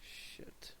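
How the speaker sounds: under-the-breath whispered swear; whispered curse. Real, not acted. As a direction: hiss it under your breath.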